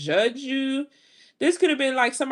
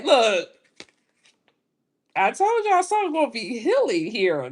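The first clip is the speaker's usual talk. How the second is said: ordinary delivery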